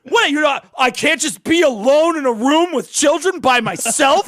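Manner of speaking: high, loud hammed-up indignation; mock outrage. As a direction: protest like an appalled quitter, hammed up. Imagined worker quitting in outrage.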